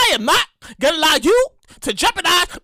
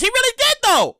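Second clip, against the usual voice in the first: ordinary talk, nothing marked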